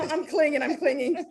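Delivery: laughing through the words, high-pitched. Real, not acted. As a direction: giggle it out, tickled. Laughing after teasing about age.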